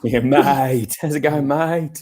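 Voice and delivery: slow, lightly hammed-up Australian accent; mock Australian accent. Guest greeting the host as an Aussie, light mock Australian accent. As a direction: stretch out the mate like a cartoon Australian.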